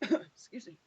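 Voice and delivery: coughing after choking; clearing throat after swallowing wrong; choking on a drink. Real, not acted. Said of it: "That went down the wrong pipe".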